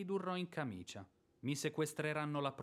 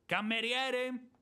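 loud, higher, drawn-out call; 7 semitones higher, 5 dB louder, slower than usual. Acted. Landowner shouts for the servant, loud. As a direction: shout for the servant across the house.

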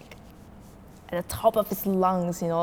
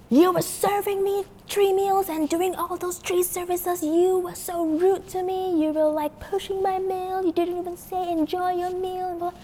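mock yelling customer; 11 semitones higher than usual. Customer accusing flight attendant of rudeness, mock indignant. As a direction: imitate a complainer listing grievances, pitched way up. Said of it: "he kept yelling and yelling".

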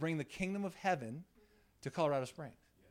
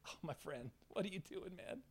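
much softer, tender voice; gentle retold reply; 2 semitones higher, 12 dB quieter than usual. Jesus to Judas who betrayed him, gentle and hushed. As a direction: say it hushed, as to a friend.

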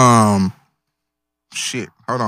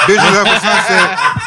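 incredulous laughing; 5 semitones higher, slower than usual. Real, not acted. Mocking friend's pick.

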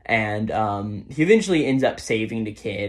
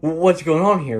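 stammered, slightly higher startled question; mild shock; 5 semitones higher, 4 dB louder than usual. Retold or imitated. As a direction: retell a stunned reaction with a stumble. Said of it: "almost stood in shock from this".

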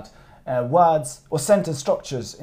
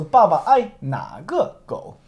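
slow, higher question rising at "naga go"; slow demonstration; 4 semitones higher, 3 dB louder, slower than usual. Shown as a demonstration. Teacher repeats on the student's request, slow and questioning. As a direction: spell it out slowly for a learner. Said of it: "Say that slow".

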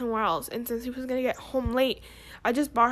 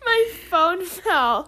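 laughing; 5 semitones higher, 5 dB louder, much slower than usual. Real, not acted. Phone just knocked over.

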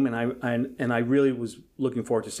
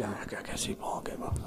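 whispered, hushed and urgent; wife's urgent whisper; 4 semitones lower, 12 dB quieter than usual. Retold or imitated. Wife whispering to husband about meeting the star. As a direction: whisper it so nobody hears. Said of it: "My wife's with me".

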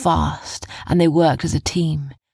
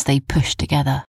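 usual speaking voice

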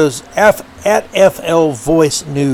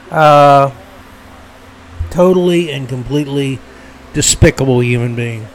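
ordinary talk

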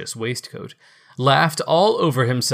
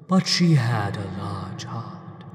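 ghost voice; 2 semitones lower, slower than usual. Acted. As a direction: speak as a gentle ghost. Ghost character voice.

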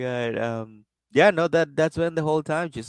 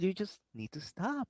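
very soft and higher, all the fight gone; defeated hush; 6 semitones higher, 12 dB quieter than usual. Retold or imitated. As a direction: sigh it out as if beaten down. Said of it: "when you stop swearing".

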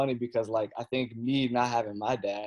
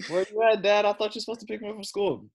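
put-on much higher, louder kid voice, fast; imitating whiny son; 11 semitones higher, 6 dB louder than usual. Dad voices his son waiting after school, higher and fast. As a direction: complain like a stranded kid.

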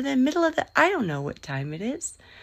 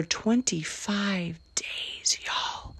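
whispered aside; 2 semitones higher, 5 dB quieter, much slower than usual. Real, not acted. Whispered aside about her cocoon.

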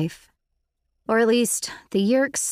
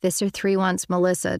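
ordinary delivery, nothing marked

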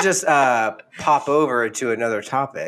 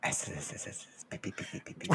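breathy hissed letters; whispered spelling; 5 semitones lower, 21 dB quieter than usual. Retold or imitated.

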